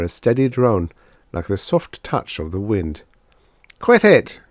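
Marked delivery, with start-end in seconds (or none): 3.8-4.4 high, loud, angry shout; furious high-pitched command; angry yell. A narrator's reading. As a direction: yell at them to stop. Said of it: "Drummond yelled angrily".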